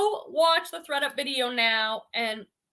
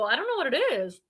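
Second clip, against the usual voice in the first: ordinary talk, nothing marked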